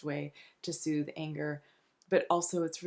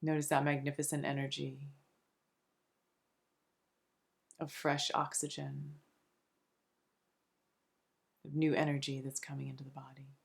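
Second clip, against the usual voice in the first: soft, soothing meditation voice with long pauses between phrases; unhurried, hushed relaxation guide; guided meditation; 2 semitones lower, 4 dB quieter than usual. Acted. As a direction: gently guide them into calm, phrase by phrase.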